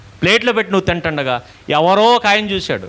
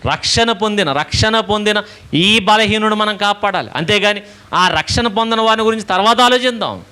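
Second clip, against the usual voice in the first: higher, insistent pulpit emphasis; fervent preaching; 4 semitones higher than usual. Acted. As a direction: drive the point home to the congregation. Preacher presses his point.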